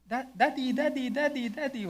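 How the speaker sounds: put-on high-pitched child calling for Daddy; high, eager kid voice, mimicked; excited child calling. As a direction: imitate a little kid running up, calling out. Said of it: "meron isang bata naglalaro".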